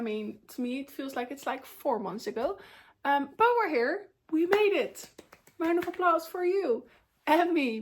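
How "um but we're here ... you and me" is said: higher, louder celebratory cheer; excited cheer. Real, not acted. Vlogger to viewers, cheering that January is over, excited and louder. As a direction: cheer like handing out applause.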